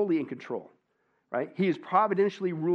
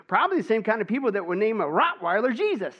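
smug parrot punchline; 7 semitones higher, 6 dB louder than usual. Acted.

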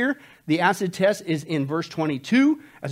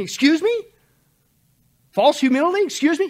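indignant disbelief; 9 semitones higher, 6 dB louder than usual. Real, not acted. Preacher challenges false humility.

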